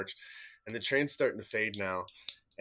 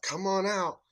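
much higher, commanding cop call; sheriff calling out; 10 semitones higher, slower than usual. Retold or imitated. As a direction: order them out like a deputy. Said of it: "He's like".

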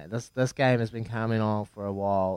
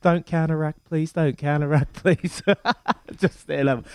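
pleading; 4 semitones higher than usual. Retold or imitated. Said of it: "I was sitting there going".

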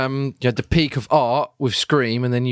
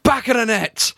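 high, laughing play-by-play; frantic mock sports commentary; 11 semitones higher than usual. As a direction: call it like a goal commentator. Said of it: "maybe he commentates while he's in the act".

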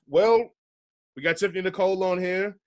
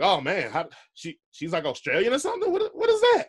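retold surprise; 3 semitones higher than usual. Host replaying his shock at [name]'s real accent, mock astonished. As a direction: act out being thrown by her accent. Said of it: "And I was like".

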